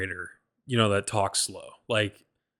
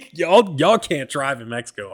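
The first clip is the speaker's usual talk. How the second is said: louder and higher, laughing disbelief at the driving; exasperated outburst; 4 semitones higher, 7 dB louder than usual. Real, not acted. Telling friends about a wild Uber ride, louder exasperated outburst. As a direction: blurt it out, half-laughing and fed up. Ranting about Mexico drivers.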